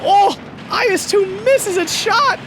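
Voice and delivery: high loud excited call; excited shout. Real, not acted. Excited play-by-play.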